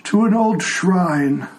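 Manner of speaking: put-on a frail old man who can't hear; old blind tiefling. Old tiefling NPC dialogue.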